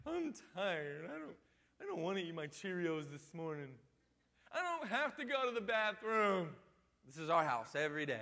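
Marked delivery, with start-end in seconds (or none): 0.1-6.5 lightly voiced whining child; a hint of a sulky kid refusing; whiny kid. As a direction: parent mimics kid refusing the bathroom, mild whine.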